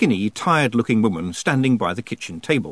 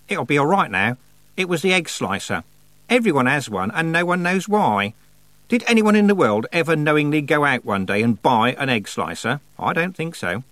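higher-pitched, chatty goddess voice; 6 semitones higher, 4 dB louder than usual. A narrator's reading. Goddess chatting about egg slicers after fixing drawer, chatty. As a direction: ramble on with mild incredulity.

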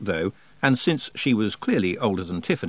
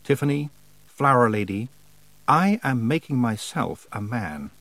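godlike being. A narrator's reading. Godlike being declares itself.

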